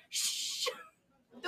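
a breathy shush, then the word softly; shushing whisper. Retold or imitated. Mom hushing her son as he shouts door, whispering. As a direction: hush the child gently. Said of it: "I'm like".

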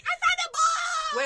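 high, drawn-out laughing yell; mock triumphant shout. Friend imagining her bursting out of the bathroom, mock shout.

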